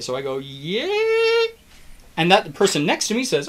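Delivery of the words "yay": high-pitched sustained "yay"; mock crowd cheer, way up high; wave cheer. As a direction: squeal out a pretend stadium cheer. Acting out a stadium wave.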